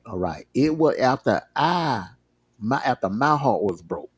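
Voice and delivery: ordinary talk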